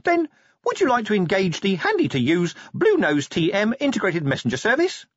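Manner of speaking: selling a gadget feature eagerly; salesy imp. Acted.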